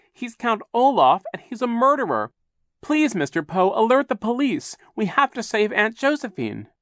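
put-on high, loud, urgent child; shouted accusation. A narrator's reading. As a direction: shout a desperate plea for help. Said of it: "Klaus shouted".

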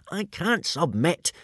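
indignant. A narrator's reading. Hiccup refuses to surrender to the dragon, slow indignant protest. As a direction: refuse with offended pride. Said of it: "said Hiccup indignantly".

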